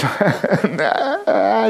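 high breathy disbelieving laugh; incredulous laugh. Retold or imitated. Reacting to insult, laugh. (ungläubiges Lachen)

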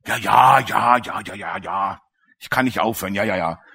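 mock whining. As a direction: sigh it off like a weary excuse-maker. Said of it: "Jammert immer".

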